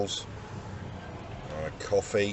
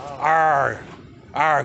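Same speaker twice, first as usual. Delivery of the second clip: pirate R; 3 semitones higher than usual. Pretend. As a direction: growl the R like a pirate. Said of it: "Give me some R's".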